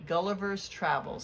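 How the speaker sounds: plain reading voice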